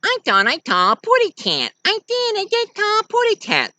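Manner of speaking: put-on high baby-talk bird voice; tiny cartoon bird. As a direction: squeak it like a little canary.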